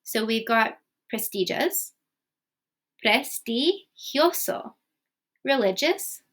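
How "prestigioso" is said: prestigioso spelled out slowly, syllable by syllable; slow Spanish pronunciation. Shown as a demonstration. Teacher slowly models the tricky Spanish word in Spanish pronunciation. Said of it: "now let's try these ones".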